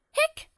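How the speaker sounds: short squeaky hiccup sound; a sharp little hiccup; high hiccup. Acted. Character has the hiccups.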